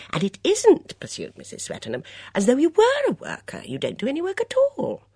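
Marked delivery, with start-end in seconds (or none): 0.1-1.0 put-on high, lively voice of a fussy older lady; a bright, gossipy mother at breakfast; chatty Mrs Swettenham. 2.3-5.0 put-on high, lively voice of a fussy older lady; a bright, gossipy mother at breakfast; chatty Mrs Swettenham. Swettenham scolding.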